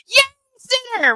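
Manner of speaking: squealing high fan cheer; mock cheering fan. Mocking fawning chat fans.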